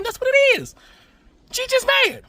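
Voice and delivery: a high-pitched jeer at the hater; mocking high voice. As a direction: tease her in a high put-on voice. Mocking sing-song about the hater.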